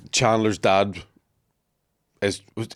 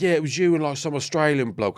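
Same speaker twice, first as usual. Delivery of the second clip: mock Cockney, pitched up; Cockney bodyguard; 6 semitones higher than usual. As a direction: put on the bodyguard's higher Cockney voice. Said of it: "big Cockney bodyguard".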